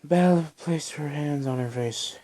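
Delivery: plain reading voice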